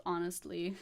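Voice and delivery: ordinary talk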